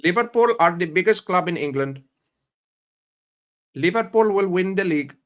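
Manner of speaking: put-on Indian accent, proclaiming; comic Indian-accented announcement. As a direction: declare it in a mock Indian accent. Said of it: "you'll think I'm fucking Indian".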